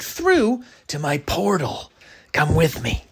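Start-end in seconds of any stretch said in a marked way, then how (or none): none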